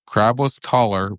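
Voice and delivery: plain reading voice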